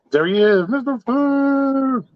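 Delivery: high, drawn-out welcome call; excited greeting. Real, not acted. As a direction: call out his name slowly, up high. Greeting a newcomer in chat.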